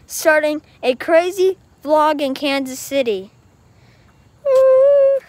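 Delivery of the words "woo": high, loud "woo"; loud held cheer; excited whoop. Real, not acted. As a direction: let out a long, high whoop. Excited whoop in vlog opening.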